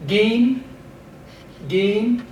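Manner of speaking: gin, ayin softened to a slight G. Shown as a demonstration. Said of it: "give that ayin a slight pronunciation".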